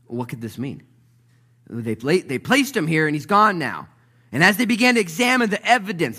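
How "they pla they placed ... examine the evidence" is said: loud, high, driving sermon delivery; full-force preaching. Acted. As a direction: preach it out, building fast.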